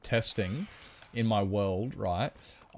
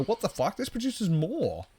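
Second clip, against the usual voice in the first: much higher, amazed mock quote; high-pitched imitation of delighted viewers; excited players; 10 semitones higher than usual. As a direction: play the stunned happy player. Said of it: "And they go".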